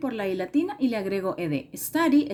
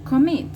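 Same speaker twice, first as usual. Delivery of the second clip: "Commit" said loud and high, weight on -MIT; stress on second syllable; 4 semitones higher, 7 dB louder than usual. Shown as a demonstration. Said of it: "la mayor entonación la lleva en".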